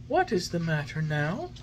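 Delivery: high, gentle fairy voice; fairy, patient. A narrator's reading. Fairy patiently coaxing the puppet. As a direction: soothe him kindly.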